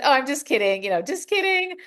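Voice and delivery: mock-embarrassed retraction, pitch climbing high; embarrassed backtracking. Trainer backtracking to the reserved guy, embarrassed. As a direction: imitate backpedalling after feeling dumb. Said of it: "I felt really dumb at that moment".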